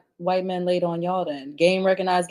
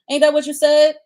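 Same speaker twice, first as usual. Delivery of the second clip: higher and louder, pointed accusation; indignant challenge; 9 semitones higher, 7 dB louder than usual. Real, not acted. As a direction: pin him down with a sharp question. Challenging him, high and loud.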